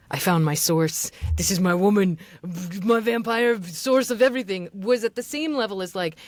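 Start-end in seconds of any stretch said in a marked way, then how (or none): 0.1-4.7 mock intense discovery. Coach imitates student's vampire passage, mock intense discovery. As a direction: imitate an overwrought audiobook revelation. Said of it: "that you feel like".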